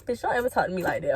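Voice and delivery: normal talking voice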